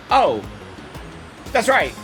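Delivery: ordinary talk, nothing marked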